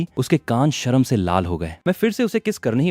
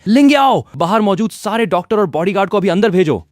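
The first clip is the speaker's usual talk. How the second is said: much higher, much louder panicked yell for help; frantic shout; 9 semitones higher, 7 dB louder than usual. A narrator's reading. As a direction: scream the name across the room in alarm. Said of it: "वो जोर से चिल्लाई". (घबराई हुई ज़ोरदार चीख)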